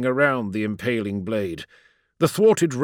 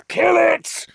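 high, loud shout of an oath; 4 dB louder than usual. A narrator's reading. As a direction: bark it as an order. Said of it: "Ra shouted".

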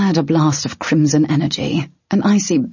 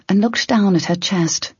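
ordinary delivery

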